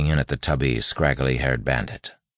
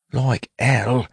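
put-on higher, tense street-accent hiss; hissed retort; 10 semitones higher, 4 dB louder than usual. A narrator's reading. Wayne refusing the bandit's second spit, hissed retort. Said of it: "Wayne hissed".

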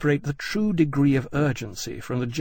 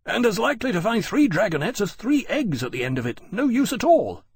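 high, agitated protest; exasperated young officer's outburst; heated objection; 9 semitones higher, 4 dB louder than usual. A narrator's reading. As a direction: protest loudly that it is pointless.

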